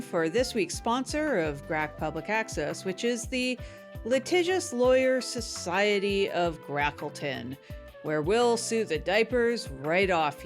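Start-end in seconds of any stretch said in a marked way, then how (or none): none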